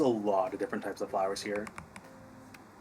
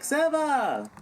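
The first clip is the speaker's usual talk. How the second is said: excited call across the garden; 5 dB louder than usual. Acted. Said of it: "He's just like".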